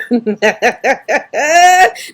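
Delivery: rising happy laughter; real amused laughter. Real, not acted. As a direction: let the laugh roll out. Laughing with live guests.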